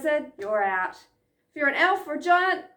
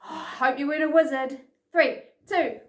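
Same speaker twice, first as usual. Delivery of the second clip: normal talking voice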